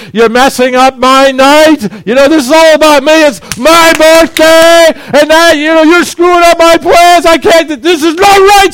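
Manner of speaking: loud, high, furious ranting; a selfish dad yelling at his ruined night; angry rant. Retold or imitated. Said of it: "I'm really angry".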